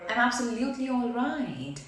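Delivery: "absolutely" lifted, "all right" falling away; modulated answer. Shown as a demonstration. Teacher showing how to answer with modulation, voice waving on "absolutely all right". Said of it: "the two ways I just demonstrated".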